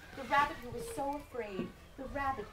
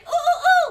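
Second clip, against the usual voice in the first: high, loud playful hoot; monkey hoot; 19 dB louder than usual. Acted. Baby monkey up the tallest tree, high loud hoot.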